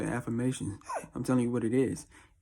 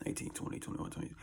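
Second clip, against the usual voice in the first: counting under his breath; unvoiced whisper to himself; 13 dB quieter than usual. Real, not acted. Muttering the years to himself.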